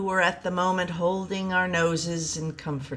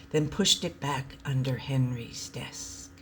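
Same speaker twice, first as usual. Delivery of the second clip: soft whispered narration; sneaky whisper; 4 semitones lower, 5 dB quieter than usual. A narrator's reading. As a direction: hush it like sneaking. Sneaking quietly.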